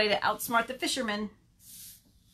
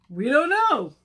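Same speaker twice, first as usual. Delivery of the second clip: loud, higher storybook chorus; emphatic group answer; 4 semitones higher, 8 dB louder, slower than usual. Acted. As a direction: answer loudly for all the animals. Animals answer together.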